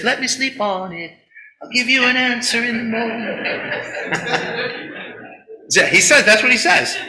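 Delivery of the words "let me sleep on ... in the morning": slow, amused, stretched-out delay; drawn-out stalling reply. Retold or imitated. Prophet to the king's messengers, slow and amused. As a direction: drag it out like a man buying time. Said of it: "and he says".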